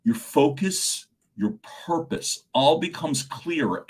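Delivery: ordinary delivery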